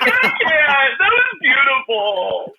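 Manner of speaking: high, slow, hammed-up teary gush; mock tearful. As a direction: play it overcome, drawing it out. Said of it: "I'm like".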